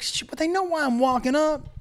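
high, strained inner panic; anxious young guy's inner voice; panicked inner voice. Retold or imitated. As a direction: squeak out the nervous thought.

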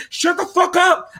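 host snapping back at his critics, angry; angry outburst. Real, not acted. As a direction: cut them off in real irritation. Ranting host snaps at critics.

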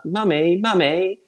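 high-pitched, very English "Mummy"; English accent. Retold or imitated. Aunt imitating her niece calling for Mummy, English accent. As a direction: mimic a child's borrowed English accent. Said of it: "in this, like, real English accent".

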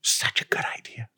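whisper. Real, not acted. Whispered repeat of praise.

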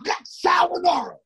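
high, far-off mock announcer voice; jeering coworker making a fuss; mocking Jamie. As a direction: ham up a big sarcastic announcement. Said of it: "he goes".

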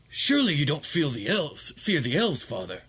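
high, interrupted protest; high-pitched startled objection; pleading son. Acted. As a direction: cut off mid-plea. Satiros pleads.

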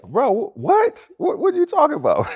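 like you can't believe what you just heard; disbelief. Retold or imitated. Telling Kobe on the phone he's lying, high disbelief.

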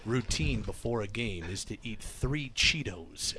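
solemn sports-doc narration; documentary narrator. Retold or imitated. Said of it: "It's like".

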